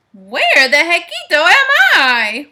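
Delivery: loud, stretched-out bewildered question; hammed-up puzzled cry with rising swoops; Scapito wondering aloud. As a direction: call out the question in cartoon bafflement. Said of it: "wondered Scapito aloud".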